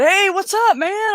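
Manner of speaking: a hint of an old buddy calling out high; lightly voiced pal, upbeat and high; friendly greeting. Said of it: "he said".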